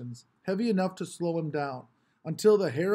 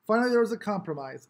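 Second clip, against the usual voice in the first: plain reading voice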